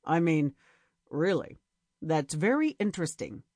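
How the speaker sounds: forced deep, low voice. A narrator's reading. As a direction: drop the voice way down. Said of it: "speaking several octaves lower".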